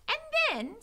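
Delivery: put-on high, loud serving-girl voice; excited. A narrator's reading. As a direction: burst out eagerly. Mig reaches favourite part.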